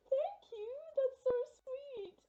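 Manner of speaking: high, slow, moved gratitude; genuinely moved by the gift; touched thanks. Real, not acted.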